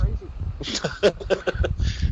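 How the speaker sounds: soft, high run of chuckles; quiet, tickled chuckle; laughing. Real, not acted. Wrestler amused that the cowboy gimmick sells, laughing softly.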